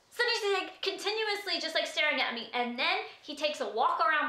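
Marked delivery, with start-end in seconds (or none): none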